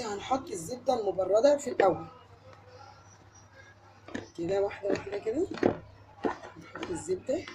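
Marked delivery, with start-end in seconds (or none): none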